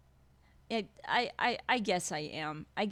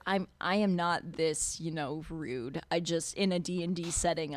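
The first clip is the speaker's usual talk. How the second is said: ordinary talk, nothing marked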